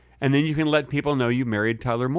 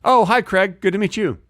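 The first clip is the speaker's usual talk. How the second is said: higher, chirpy mock greeting; party greeting; 7 semitones higher, 4 dB louder than usual. [name] playing a guest greeting Craig, higher pitched. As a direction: answer like a polite stranger.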